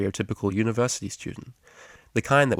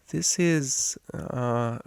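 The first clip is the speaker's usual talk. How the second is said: hesitant, halting small mare, a touch higher; nervous; 4 semitones higher, much slower than usual. A narrator's reading. Shy student introduces classmate, nervous and hesitant. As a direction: stumble timidly over the name. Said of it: "a small mare said nervously".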